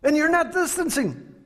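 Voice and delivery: high-pitched scolding imitation; mock ranting. Angry customer scolding over distancing, mock ranting. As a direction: screech it as a hammed-up scolding. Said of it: "he's ranting and he's raving".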